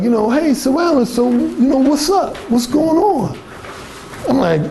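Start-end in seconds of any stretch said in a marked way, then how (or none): 0.3-3.4 lightly high fake-friendly caller; a hint of fake cheer; mock phony caller. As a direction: parody the aimless caller. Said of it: "they'll just be like".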